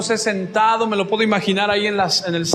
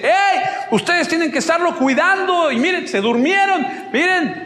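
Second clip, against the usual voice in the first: shouting across; 7 semitones higher than usual. Retold or imitated. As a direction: yell it out to be heard far away. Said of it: "le grita".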